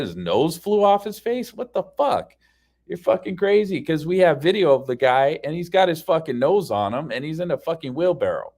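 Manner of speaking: high-pitched, exasperated argument; heated, disbelieving rebuttal; incredulous outburst. Retold or imitated. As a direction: throw the absurd claim back, voice way up. Retelling his side of argument.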